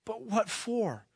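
high, drawn-out bewildered question; pleading boy, slow and high. Acted. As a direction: ask slowly in dismay, pitch way up.

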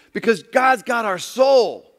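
slow, loud and high, landing hard on soul; loud preached climax. Acted. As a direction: hammer the point home from the pulpit.

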